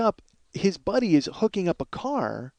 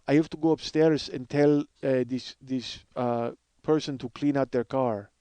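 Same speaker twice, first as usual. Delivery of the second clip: halting Russian repo man; Russian-accented, sinking lower and softer; 3 semitones lower, 4 dB quieter than usual. Acted. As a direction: do the Russian guy hunting for words. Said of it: "I love doing this Russian guy's voice".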